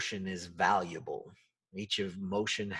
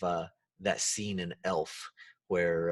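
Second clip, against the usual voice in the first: usual speaking voice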